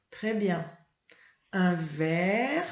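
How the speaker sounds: usual speaking voice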